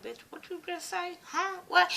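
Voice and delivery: high, hushed stunned double-take; imitating the father hearing his son died; shocked disbelief. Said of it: "he looking at her like".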